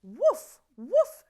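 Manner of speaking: loud high barking woof; dog bark. Acted. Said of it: "said Dog".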